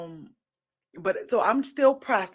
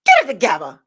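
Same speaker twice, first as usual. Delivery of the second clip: lightly voiced cousin telling her off, louder; cousin's scolding; 3 semitones higher, 9 dB louder, faster than usual. Cousin hitting her with tough love, mock scolding. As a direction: imitate a loved one's firm pep talk. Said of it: "she'll hit me with the".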